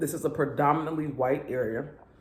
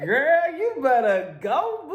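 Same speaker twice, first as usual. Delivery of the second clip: laughing hype; 11 semitones higher, 3 dB louder, slower than usual. Retold or imitated. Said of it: "I was like".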